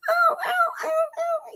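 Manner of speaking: very high-pitched excited yelps; squeaky, repeated dog howls; excited dog impression. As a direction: imitate a little dog's vocal yowling. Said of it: "when he gets excited, he's like".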